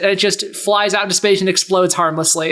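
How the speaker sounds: normal talking voice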